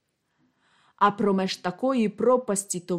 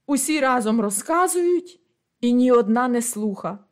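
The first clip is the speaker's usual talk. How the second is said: usual speaking voice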